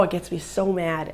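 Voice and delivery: plain reading voice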